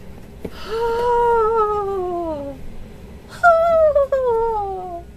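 high, drawn-out gasp with a cry; long gasp of delight. Real, not acted. Seeing the gift for the first time, a long high gasp. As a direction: gasp in overwhelmed joy.

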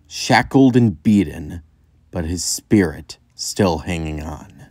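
usual speaking voice

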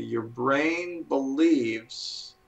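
very slow, drawn-out words; really slowly. Shown as a demonstration. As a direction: spell it out slowly, word by word. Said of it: "I say it really slowly".

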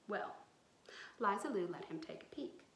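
plain reading voice

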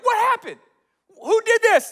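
loud, high outraged yell; mad. Retold or imitated. Townspeople finding their idols knocked down, mad. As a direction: demand answers in acted fury. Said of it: "People are all mad".

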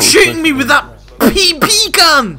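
high, loud, half-laughing shriek; high loud whiny yell; shrieking protest. Real, not acted. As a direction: shriek it out at the one shooting you. Shrieking at shooter.

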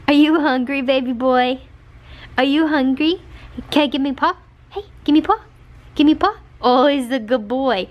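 high, lively doting voice; dog-talk. Real, not acted. Owner to hungry Marsh asking for paw, doting dog-talk. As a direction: coax and praise a dog in a high voice.

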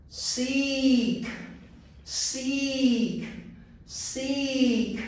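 "Leap", "feel", "Seek" with a long, high ee held out; long E words. Shown as a demonstration. Teacher drilling long E words for students to repeat. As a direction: drill each word slowly, stretching the ee. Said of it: "read the first long E word".

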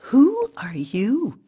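high, slow puzzled question. A narrator's reading.

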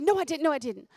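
high, insistent denial; child's protest. Retold or imitated. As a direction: protest like a caught toddler. Child denying to mother.

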